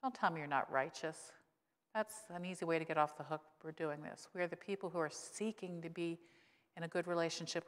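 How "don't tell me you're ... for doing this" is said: usual speaking voice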